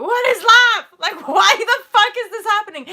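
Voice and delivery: high, loud, exasperated cry; desperate outcry. Retold or imitated. Vlogger reaching out to close friends, loud and desperate. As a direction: yell it to your friends in despair. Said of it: "to go".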